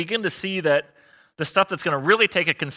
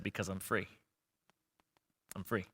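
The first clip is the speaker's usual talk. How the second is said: very soft, low quote; hushed retold answer; 6 semitones lower, 16 dB quieter than usual. Pastor retells a dying woman's answer to his question, hushed. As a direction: quote her softly, letting it sink in.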